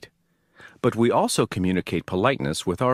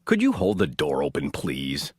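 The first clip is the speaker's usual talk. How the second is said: low, flat, curt demand; impolite request; 7 semitones lower than usual. Shown as a demonstration. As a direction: bark it without courtesy. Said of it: "The second is not".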